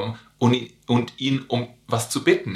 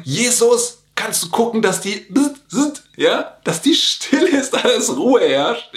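high, pleading whine of flustered disciples; embarrassed disciples; 10 semitones higher than usual. Retold or imitated. Disciples to Jesus, high hammed-up embarrassed plea. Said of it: "die Leute fangen schon an zu gucken".

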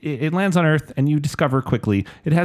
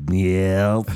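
odd, otherworldly alien yeah; 4 semitones lower than usual. Pretend. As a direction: answer like a strange alien. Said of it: "That was your alien voice".

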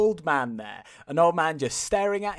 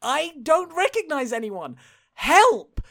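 mock plea; 9 semitones higher, 3 dB louder than usual.

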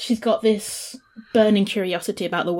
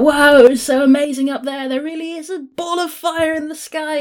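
higher and louder, bubbling with wonder; excited Melody; 7 semitones higher, 5 dB louder than usual. Retold or imitated. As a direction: gush about it like a kid who saw a miracle. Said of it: "she tries to tell everyone about".

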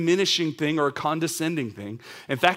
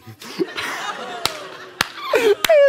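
high, loud helpless laugh; real laugh at the wife's joke; burst of laughter; 5 dB louder than usual. Real, not acted. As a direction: crack up at your own story. Speaker cracks up at story.

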